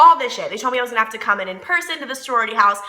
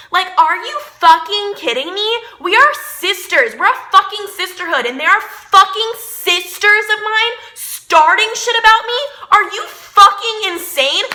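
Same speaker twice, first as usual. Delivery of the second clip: pitched up high and loud with outrage; furious rant; 9 semitones higher, 5 dB louder than usual. Retold or imitated. Telling the audience about the rumors, furious and shrill. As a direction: explode with disbelief at the sisters. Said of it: "I don't think I've ever been so angry".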